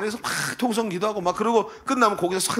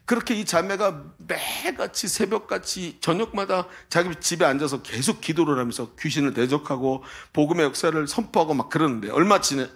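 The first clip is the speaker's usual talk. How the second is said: normal talking voice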